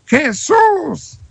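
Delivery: high, ringing cry of "Jesús"; shouting. Acted. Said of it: "gritó a todo pulmón". (grito agudo de "Jesús")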